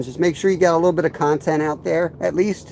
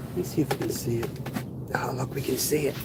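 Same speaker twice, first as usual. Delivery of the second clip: hushed; 10 dB quieter than usual. Real, not acted. Leaning close to watch the line.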